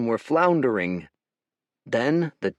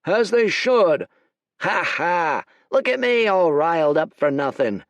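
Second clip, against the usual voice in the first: loud, higher, laughing bluster; boasting general; 7 semitones higher, 5 dB louder than usual. Acted. General gloats to the army, loud laughing bluster. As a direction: brag with a hearty laugh.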